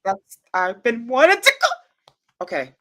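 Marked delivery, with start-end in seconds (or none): 0.5-1.7 can barely contain the excitement; overexcited. Real, not acted. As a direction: build into a squeal of excitement on "to go". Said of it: "I have to calm down".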